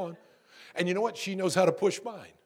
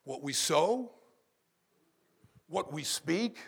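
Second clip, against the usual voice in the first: usual speaking voice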